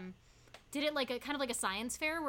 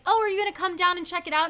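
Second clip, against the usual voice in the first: excited; 6 semitones higher, 12 dB louder, faster than usual. Retold or imitated. Parents passing, excited invitation.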